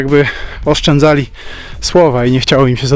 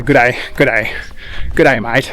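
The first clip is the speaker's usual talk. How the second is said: Australian greeting; 3 semitones lower, 3 dB quieter than usual. Retold or imitated.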